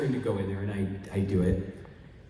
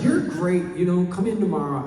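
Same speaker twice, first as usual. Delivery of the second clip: louder, higher eager pitch; eager praise; 8 semitones higher, 5 dB louder than usual. Retold or imitated. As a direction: gush at him. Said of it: "And he goes".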